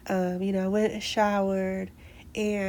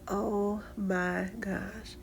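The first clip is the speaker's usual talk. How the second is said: 1 soft awed gasp; 8 dB quieter, much slower than usual. Retold or imitated. Seeing the puppy on camera.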